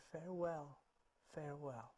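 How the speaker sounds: very soft, fading echo; little echoing voice. Acted. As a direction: answer tiny and faint from the woods. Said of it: "a little voice came from the woods".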